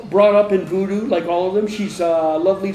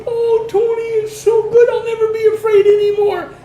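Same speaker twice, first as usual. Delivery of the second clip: put-on a small girl bursting with relief; relieved little girl; 7 dB louder than usual. Girl Lovely telling Tony her fear is gone, high joyful child voice. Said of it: "She showed me how she prayed".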